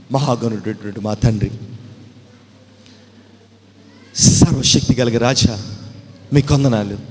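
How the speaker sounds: loud praise to God; fervent prayer. Acted. Pastor leads the congregation praising God loudly.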